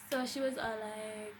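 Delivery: normal talking voice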